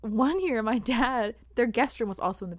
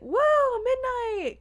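put-on high, loud drunken whoa; loud tipsy party voice; drunk exclamation; 6 dB louder, much slower than usual. Retelling drunk host's exclamation.

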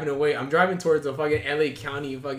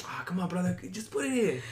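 higher mock-accented wheedling; convincing coaxer; 3 semitones higher, 4 dB quieter than usual. Date pushing her to hand over card, imitated coaxing. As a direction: coax like a persistent date. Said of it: "Very convincing".

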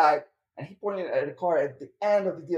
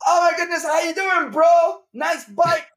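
very high, loud gushing greeting; overeager salesman; 12 semitones higher, 6 dB louder, slower than usual. Retold or imitated. Car salesman rushing out to greet a customer, high and loud. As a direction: greet him like a long-lost buddy. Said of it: "This guy came out".

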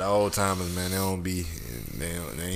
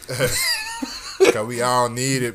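slow, said through real laughter; laughing; 3 semitones higher, 6 dB louder, much slower than usual. Real, not acted. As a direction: laugh the words out, drawn out. Laughing at shared joke.

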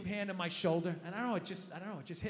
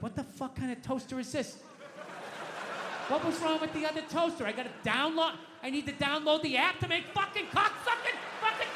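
hammed-up angry rant, climbing higher; flipping out; 5 semitones higher than usual. Dad raging at the new toaster app, flipping out. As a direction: build from irritated to a cursing fit. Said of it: "whenever I start flipping out".

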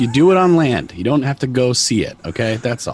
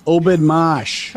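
ordinary talk

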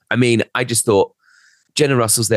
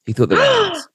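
much higher, mock scandalised; scandalised emphasis. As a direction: land the gossip with a gasp in the voice.

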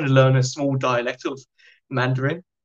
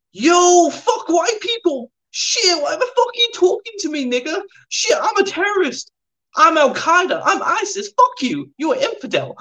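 very high-pitched, hammed-up ranting impression; ranting inmate; 4 dB louder than usual. Retelling a cellmate's terrorist boast, high hammed-up rant. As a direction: imitate a wild, aggressive rant way up high.

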